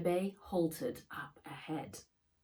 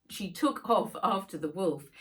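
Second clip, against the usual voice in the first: ordinary delivery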